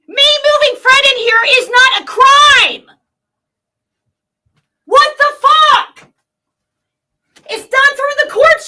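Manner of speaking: loud, high, furious yelling at her; rising into a full angry shout; furious rant. Real, not acted. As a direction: shout it out in real rage.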